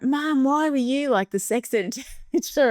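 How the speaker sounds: high, slow, drawn-out complaint; mock-aggrieved teenager; imitated embarrassed kids. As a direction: whine at Mum in mock mortification, laugh creeping in. Kids' embarrassed complaint to mum.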